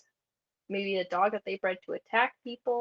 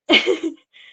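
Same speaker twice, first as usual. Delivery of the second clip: burst of laughter; 8 semitones higher, 11 dB louder than usual. Real, not acted.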